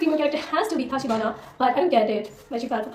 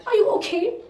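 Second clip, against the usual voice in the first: higher, gently worried question; worried concern; 8 semitones higher than usual. Retold or imitated. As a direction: fan checking on Mikey, worried and higher. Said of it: "I was like".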